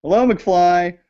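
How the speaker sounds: high sing-out to a missing guest; calling out. Acted. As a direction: call out teasingly for an answer.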